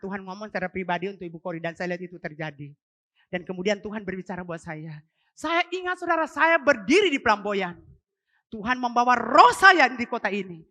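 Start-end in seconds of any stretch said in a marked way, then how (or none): 5.4-10.7 loud, high, slow preaching climax; preaching at full force. Real, not acted. Preacher recalling a vision to the church, loud and high. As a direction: preach it out over the crowd.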